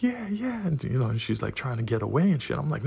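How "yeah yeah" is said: high-pitched imitation of her cries; squealed mock "yeah". Imitating the woman's screamed cries.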